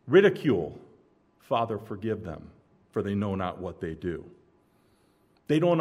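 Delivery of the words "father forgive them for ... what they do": tender plea. Retold or imitated. Repeated softly, lower, as Jesus.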